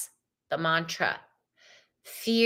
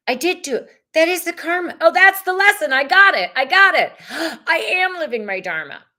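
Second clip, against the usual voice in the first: higher, much louder, building joyous breakthrough; excited realization; 8 semitones higher, 7 dB louder than usual. Retold or imitated. Reader acting out James realizing his lesson, excited building shout. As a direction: burst out as if it finally clicked. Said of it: "So, like".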